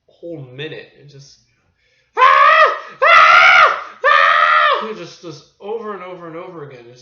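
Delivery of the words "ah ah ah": repeated piercing mock screams; imitated screaming. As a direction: scream over and over at full volume. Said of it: "listen to this woman scream".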